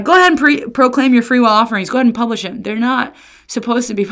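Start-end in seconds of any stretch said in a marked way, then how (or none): 0.0-2.6 raised, mocking go-ahead; sarcastic. God daring Israel to publish offerings, sarcastic. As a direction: dare them with mock approval. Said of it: "he's like".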